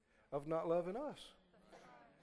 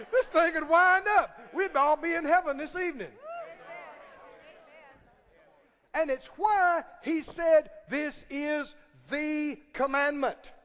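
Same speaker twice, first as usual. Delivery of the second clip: loud, high preaching shout; preaching at full force; 7 semitones higher, 8 dB louder than usual. Acted. Preacher shouting the commandment to the congregation, full force. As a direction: rise up and preach it out.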